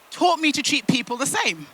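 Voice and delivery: ordinary delivery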